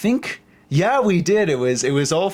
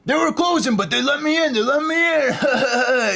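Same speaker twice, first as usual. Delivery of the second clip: gleeful boast; 9 semitones higher than usual. Retold or imitated. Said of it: "he's like".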